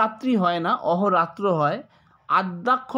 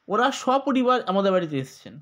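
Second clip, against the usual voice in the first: ordinary talk, nothing marked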